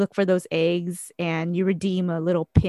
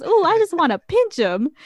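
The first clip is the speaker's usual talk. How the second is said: high, loud, gushing imitation; Sad Eye Joe; 9 semitones higher, 6 dB louder than usual. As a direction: mimic a character cooing over someone, pitched up. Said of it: "He goes".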